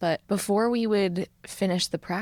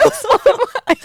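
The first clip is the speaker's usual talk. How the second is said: much higher, laughing fast and loud; 10 dB louder, much faster than usual. Real, not acted. Co-host laughs while admitting she is emotional too.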